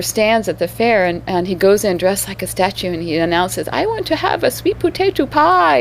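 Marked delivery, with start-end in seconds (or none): 3.7-5.8 put-on French take on Southern accent. French statue partner orders pie, French accent mimicking Southern. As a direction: announce it like a Frenchman faking the South. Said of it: "trying to imitate the Southern accent with a French accent".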